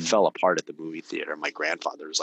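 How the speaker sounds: normal talking voice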